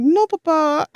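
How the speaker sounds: put-on child's French accent, pleading. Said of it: "his little French accent".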